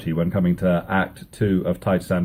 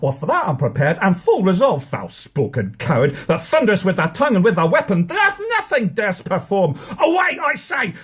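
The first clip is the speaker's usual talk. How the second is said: angry defiance; 11 semitones higher than usual. Acted. Rival prince shouts back.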